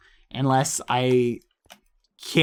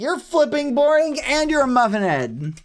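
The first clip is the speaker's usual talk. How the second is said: put-on high, loud childish comeback; heated, indignant yelling; yelled insults; 10 semitones higher, 5 dB louder than usual. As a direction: fire insults back at a friend. Trading insults with Quackity.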